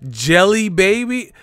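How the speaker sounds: incredulous. Real, not acted. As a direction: repeat the name in disbelief. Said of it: "that sound like some welfare shit".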